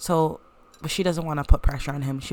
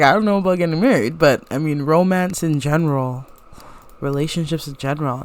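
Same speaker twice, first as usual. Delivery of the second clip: louder and fast, dodging; flustered brother; 8 dB louder than usual. Acted. Brother dodging talk of marriage, louder and fast. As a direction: rush it out louder, squirming away from the topic. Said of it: "So he's like".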